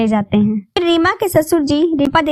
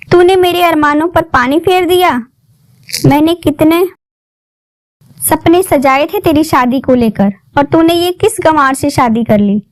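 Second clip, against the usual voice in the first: angry; 4 semitones higher, 5 dB louder than usual. A narrator's reading. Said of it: "उसका गुस्सा सातवें आसमान पर था".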